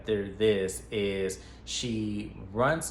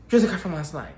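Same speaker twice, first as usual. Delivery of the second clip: accusing; 6 semitones higher, 7 dB louder, faster than usual. Retold or imitated. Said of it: "And she goes".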